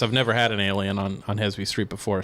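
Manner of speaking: ordinary talk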